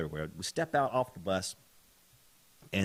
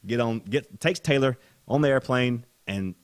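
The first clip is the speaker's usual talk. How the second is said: usual speaking voice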